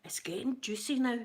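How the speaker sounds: hushed, breathy gossip whisper; conspiratorial mock whisper; gossipy whisper. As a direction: lean in and whisper the juicy news. Gossiping about neighbourhood drama.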